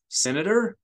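sharp, high, loud call of his title; alarmed cry into the smoke; urgent call. A narrator's reading. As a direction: shout to rouse a dazed man. Aide calls to dazed senator.

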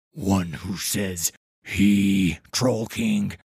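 low, slow, loud troll growl; rumbling gravelly voice. A narrator's reading. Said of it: "with a rumbling, gravelly voice".

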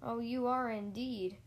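usual speaking voice

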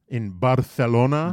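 BARCELONA with a Castilian lisped th on the c; correct Spanish pronunciation. Shown as a demonstration. Host correcting his co-host, Spanish pronunciation of Barcelona. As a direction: say Barcelona as "Barthelona", questioning and correcting. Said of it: "I just want you to pronounce it correctly".

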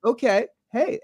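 startled reply. Retold or imitated. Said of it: "I'm like".

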